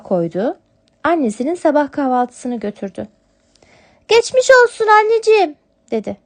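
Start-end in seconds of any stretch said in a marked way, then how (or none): 4.1-5.5 much higher, louder kitten wishing her mother well. Acted. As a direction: kitten brings breakfast to sick mother. (yavru kedi sesi, şefkatli)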